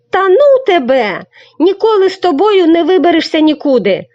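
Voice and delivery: high loud angry yell; angry shout. Acted. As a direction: shout him down in annoyance. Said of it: "сердито закричав Сашко Циган". (сердитий гучний крик)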